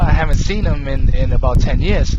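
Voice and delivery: ordinary talk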